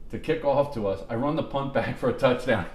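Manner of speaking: normal talking voice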